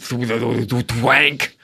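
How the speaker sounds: drawn-out springy trap noise; vocal sound effect, long wobble into a twang; imitated snare twang. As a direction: mimic a wire whipping and snapping tight. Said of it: "So there's, like, a weird".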